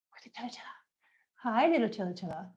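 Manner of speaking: soft whisper to a shy cat; hushed pet talk; whispered coaxing. Real, not acted. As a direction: lure her in with a hush.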